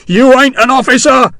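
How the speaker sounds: refusing in panic while hanging over a ledge; panicked protest. Acted. Hexwell protesting to Sharp from the window ledge. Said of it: "Hexwell protested".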